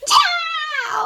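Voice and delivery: playful shout. Acted. Ripping the boat in the storm.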